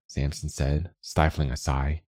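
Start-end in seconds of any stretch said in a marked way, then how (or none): none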